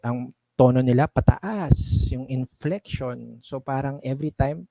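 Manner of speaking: usual speaking voice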